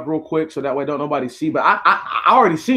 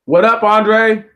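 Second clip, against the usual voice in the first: loud called greeting; 6 semitones higher, 6 dB louder, slower than usual. Real, not acted. Greeting a friend, called out loud. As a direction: shout the greeting across the room.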